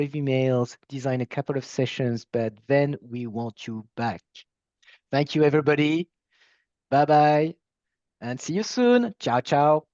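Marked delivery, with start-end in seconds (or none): 5.1-9.9 cheery goodbye. Real, not acted. Cheerful sign-off to the audience.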